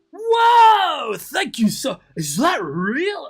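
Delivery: real stunned whoa, loud and high; sudden high yell of disbelief; shocked shout. Real, not acted. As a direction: blurt it out, floored by the gift. Shocked by a huge donation.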